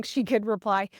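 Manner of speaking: ordinary talk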